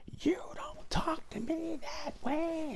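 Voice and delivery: mock screaming. Manager reporting what he supposedly screamed, mock screaming. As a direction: mimic an exaggerated accusation of yelling. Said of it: "reported that you yelled and screamed".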